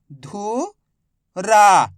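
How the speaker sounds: धुरा drawn out slowly as धु... रा; stretched syllables. Shown as a demonstration. Teacher to pupils, stretching धुरा slowly. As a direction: spell out धुरा syllable by syllable, very slow. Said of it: "पढ़ने की कोशिश".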